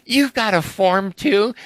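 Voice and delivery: high, slow incredulous re-enactment; astonished question. Retold or imitated. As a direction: draw it out high in mock amazement. Acting out his astonished reply.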